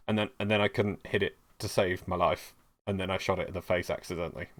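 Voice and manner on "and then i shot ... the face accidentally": ordinary delivery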